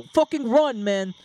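loud, high-pitched yell to flee; shouted, pitch leaping up; panicked yell. Acted. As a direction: yell to your buddy to bolt. Henchmen yell as they flee.

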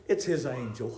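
very low, hushed quote; soft, low doubters' verdict; quiet dismissal. Acted. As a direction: wave it away under your breath.

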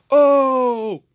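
high, loud burst of shock; kids' stunned yell. Acted. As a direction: yell it like the whole class just heard gossip. Friends react to news.

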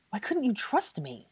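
frustrated, reproachful question; aggrieved reproach. A narrator's reading. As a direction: ask it in exasperation. First of two mirrored reproaches.

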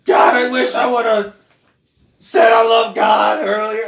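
hammed-up lament from the flames; mock damned soul. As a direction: wail like a sinner burning in hell. Damned soul regretting in hellfire.

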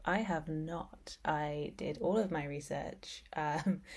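ordinary delivery

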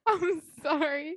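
high, slow, tearful laugh; almost crying with laughter; laughing apology. Real, not acted. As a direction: apologise through helpless laughter. Laughing apology after mispronouncing a word.

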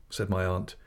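usual speaking voice

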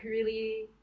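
ordinary delivery

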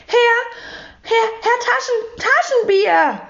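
hoch und stockend nach ihm rufen; erschrockener Ausruf. A narrator's reading. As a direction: call out high, stumbling over the name, then stretch it. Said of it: "rief Frau Rotkohl". (hohes, stotterndes Rufen)

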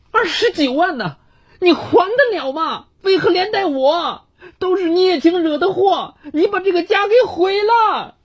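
high, shrill furious yell; roaring. A narrator's reading. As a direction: bellow at the husband. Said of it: "张丽对丈夫吼道".

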